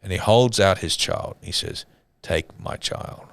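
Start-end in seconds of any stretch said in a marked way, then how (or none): 2.2-3.2 slow, near-whispered retold surrender; hushed offering. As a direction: hand it over softly, almost voiceless. Father hands over child, retold solemnly.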